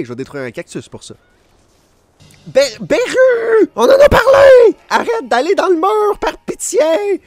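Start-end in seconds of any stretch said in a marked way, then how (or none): 2.5-7.2 frantic pleading yell, much higher; shouting match. Real, not acted. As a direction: yell at him in exasperation. Yelling at monster stuck in wall.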